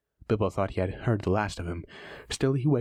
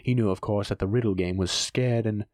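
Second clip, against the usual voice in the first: usual speaking voice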